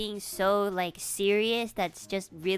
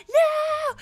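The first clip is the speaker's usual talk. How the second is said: very high, loud mimicked child's scream; screaming kid; 8 dB louder than usual. Kids held down to have wounds cleaned, mimicked shriek. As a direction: squeal it out like a protesting kid. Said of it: "they'll be like".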